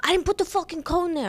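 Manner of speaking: high New York accent, imitating an angry guy; mock New York street argument; New York screaming match. Woman retells street fight, mock New York yelling. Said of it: "they were screaming".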